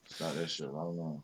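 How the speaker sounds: stern words squeezed through teeth; low warning through clenched teeth; through gritted teeth. Retold or imitated. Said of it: "talking through my teeth".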